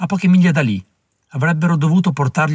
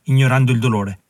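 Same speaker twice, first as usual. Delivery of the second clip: ordinary delivery, nothing marked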